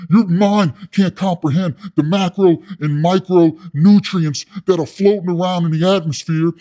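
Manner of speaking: loud, high, ranting inmate impersonation; amped-up cellmate. Retold or imitated. Inmate lecturing soy boy on his wavelength, amped. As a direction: rant grandly, putting the soy boy down. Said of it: "this dude's freaking amped".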